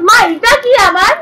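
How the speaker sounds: high, loud questioning shout; calling out to mother. Real, not acted. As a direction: call across the house to mother.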